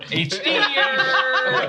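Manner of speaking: slow, laughing repeat; laughing echo. Real, not acted. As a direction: repeat it slowly through a laugh. Echoing the joke.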